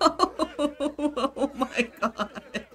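high, loud, long-drawn words through laughter; helpless laughter. Real, not acted. Player watching the date go wrong, helpless laughter. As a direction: laugh so hard the words stretch out.

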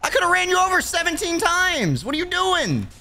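high-pitched startled whoa, shouting at a player in the road; startled yell. Acted. Lyft driver shouting at a pedestrian he nearly hit, high and startled. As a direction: yelp in alarm at someone stepping in front of the car.

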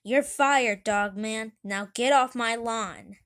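loud, indignant outburst; furious boss shouting, acted; angry firing. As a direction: bellow it at someone you are throwing out. Mayor fires the dog angrily.

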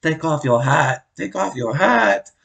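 hammed-up angry girlfriend voice; imitated angry insults. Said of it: "she just starts capping off".

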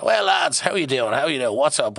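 breezy director. Retold or imitated. Director cheerily greeting the lads at the shoot. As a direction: greet the lads like old pals.

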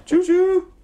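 high, loud choo-choo; train whistle imitation. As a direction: hoot playfully like a toy train.

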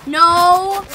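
high, loud yell at an opponent; angry shouting. Real, not acted. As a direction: yell as if chasing someone down. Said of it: "I'm angry".

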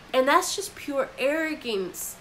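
normal talking voice

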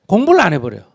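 normal talking voice

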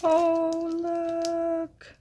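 high, sustained coo of wonder; breathtaken delight. Real, not acted. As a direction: hold the words long and high, amazed. Gasps at next gift, shaking.

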